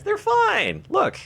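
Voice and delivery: high, loud, insistent outburst; loud protest. Real, not acted. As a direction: burst out in high-pitched reassurance. Protesting worry about the sheep.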